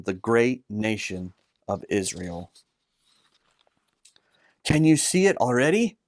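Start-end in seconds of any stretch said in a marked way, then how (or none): none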